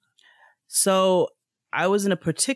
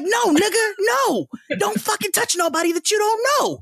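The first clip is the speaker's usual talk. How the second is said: furious retold yell. Yelling at the man who grabbed her arm, much higher furious yell. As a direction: tear into him for grabbing you.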